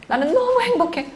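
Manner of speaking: high, bright cry of joy; a mother showing joy; happy exclamation. Shown as a demonstration. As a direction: burst out with happiness. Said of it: "빨리 말아요".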